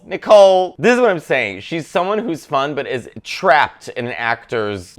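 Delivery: usual speaking voice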